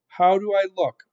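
lightly voiced eager serving girl. A narrator's reading.

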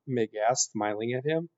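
ordinary delivery

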